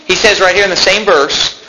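normal talking voice

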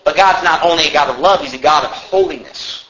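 normal talking voice

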